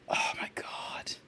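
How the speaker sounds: breathless whispered disbelief, slow; stunned whisper. Real, not acted. Streamer reading kiwi history, whispering in stunned disbelief. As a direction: whisper it, floored by the fact.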